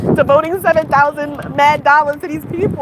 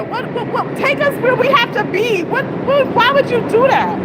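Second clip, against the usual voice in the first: high indignant disbelief; 9 semitones higher than usual. Real, not acted. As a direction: demand why they'd do that to her. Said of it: "I'm so angry".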